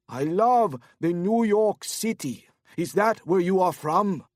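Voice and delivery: put-on a happy drunk abroad, Korean accent; cheery drunk Korean man shouting over the music; tipsy Korean businessman.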